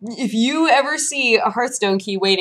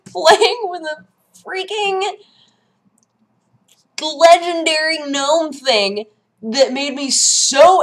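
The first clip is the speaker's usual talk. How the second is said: indignant high ranting; 8 semitones higher, 4 dB louder, slower than usual. Retold or imitated. Outraged retelling.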